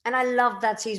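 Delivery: ordinary talk, nothing marked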